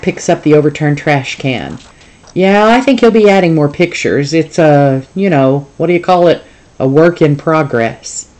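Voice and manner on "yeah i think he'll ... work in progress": salesy zoo boss. Acted. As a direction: sell it like a roadside ad. Boss boasting on phone.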